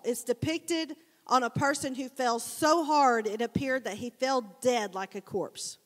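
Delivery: ordinary talk, nothing marked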